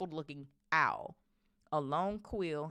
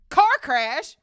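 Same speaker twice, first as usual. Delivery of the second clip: loud, raised-pitch outraged bellow; Hagrid's roar; 12 semitones higher, 18 dB louder than usual. A narrator's reading. Hagrid jumping up angrily at the lie, roaring. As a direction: roar the question out. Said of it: "roared Hagrid".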